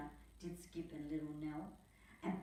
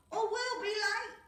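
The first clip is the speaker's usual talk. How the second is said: high, louder urgent voice; hurrying Skip; 8 dB louder than usual. Acted. Skip hurrying the crew, high loud urgent voice. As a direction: urge the crew on board. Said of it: "said Skip".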